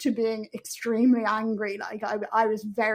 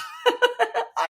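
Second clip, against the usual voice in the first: bright high burst of laughter; 13 semitones higher than usual. Real, not acted. Shouting out the epidural to viewers, laughing. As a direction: laugh at your own joke. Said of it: "laughing at own remark".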